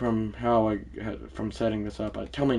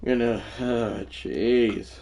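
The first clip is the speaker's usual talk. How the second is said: usual speaking voice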